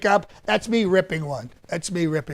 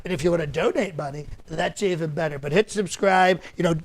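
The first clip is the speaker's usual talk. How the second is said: ordinary talk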